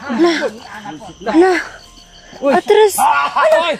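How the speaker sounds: loud alarmed shouts; shouting. Acted. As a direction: yell at them to back off. Creatures attack, calling out.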